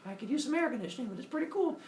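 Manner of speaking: put-on high, girlish gushing voice; queen voice. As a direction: gush like a thrilled fangirl. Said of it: "And she's like".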